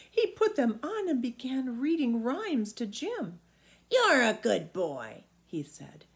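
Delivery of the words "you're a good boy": put-on a big old giant savouring the thought of food; an old giant relishing a juicy boy. Old giant voiced in story.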